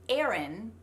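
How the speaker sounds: "Aaron" pronounced as "Erin"; American E vowel. Shown as a demonstration. Coach explains her confusion, "Aaron" sounding like "Erin". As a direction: give "Aaron" a flat E start. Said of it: "sounds like the name that starts".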